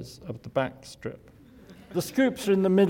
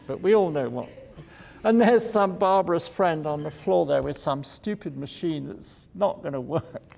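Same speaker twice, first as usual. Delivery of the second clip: normal talking voice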